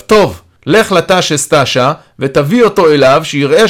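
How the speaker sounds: calling across. A narrator's reading. Yaron calls instructions to the carer opposite, calling out. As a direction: call the order over a distance. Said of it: "ירון קורא אליו".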